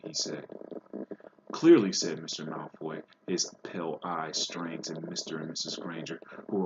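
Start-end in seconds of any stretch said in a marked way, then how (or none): 1.5-1.9 cutting sneer. A narrator's reading. Lucius's cutting reply.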